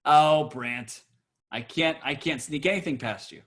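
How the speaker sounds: ordinary delivery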